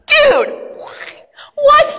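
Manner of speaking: high, yelped "what? what?"; squeaky stunned double take; shocked disbelief. Acted. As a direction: leap up in surprise. Shocked reaction to news.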